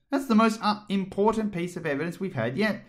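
high, anxious king; fretful king voice. A narrator's reading. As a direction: wring the words out nervously. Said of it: "said the king, wringing his hands".

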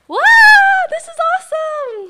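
gasp, then a high, loud burst of delight; thrilled, shout it out; excited shout. Real, not acted. Hair finally turning pink.

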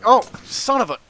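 high, loud burst of exasperation; cut-off curse, yelled; frustrated yell. Real, not acted. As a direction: yell as the game gets you. Caught out by the game.

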